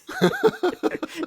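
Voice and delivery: squealing laugh; high laughter burst. Real, not acted. Telling a friend a silly story, high laugh.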